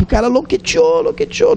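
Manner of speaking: very high mocking voice; high-pitched taunt that he's gone mad; mocking squeal. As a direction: squeal it like a scoffer. Mimics crowd calling him crazy.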